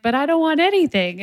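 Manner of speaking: put-on high-pitched toddler protest; mock tantrum, pitched well up; whining child. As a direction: act out a child refusing everything. Acted child in escalating tantrum.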